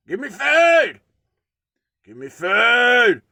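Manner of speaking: loud, high squawking demand, slowing down; demanding bird voice. Retold or imitated. Voicing the water hens begging him for food, loud high squawk. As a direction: screech it like a greedy bird begging.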